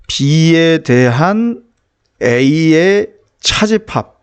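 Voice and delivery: slow, drawn-out reading of B에 대한 A의 차집합; teacher spelling out the term slowly; reading-aloud demo. Shown as a demonstration. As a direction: stretch out each part of B에 대한 A의 차집합. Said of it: "이렇게 읽어야 되겠죠".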